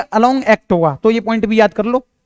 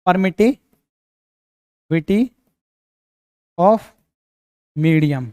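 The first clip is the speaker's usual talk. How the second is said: dictation; 2 semitones lower, much slower than usual. Shown as a demonstration. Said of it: "इसको क्या बोलेंगे?".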